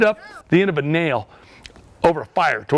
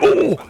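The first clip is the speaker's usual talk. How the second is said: high, louder "ooh" of pain; mock pained yelp; 13 semitones higher, 5 dB louder than usual. Storyteller reliving the ant biting him, mock pained yelp. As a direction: re-enact a sudden sting with a high yelp. Acts out reaction to ant bite.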